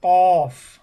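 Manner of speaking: loud, held কফ from the tongue root; forceful demonstration of back-of-mouth কফ; tongue-root articulation. Shown as a demonstration. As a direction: hit কফ hard with the back of the tongue on the palate. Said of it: "আমরা এভাবে উচ্চারণ করতে পারি".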